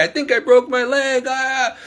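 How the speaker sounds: high, wailing mock cry of pain; imitated crying scream. Imitating the fallen neighbor in pain, high crying scream. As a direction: pitch it way up like someone hurt. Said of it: "crying and screaming".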